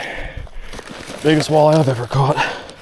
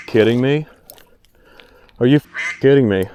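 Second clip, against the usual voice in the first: normal talking voice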